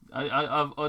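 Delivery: usual speaking voice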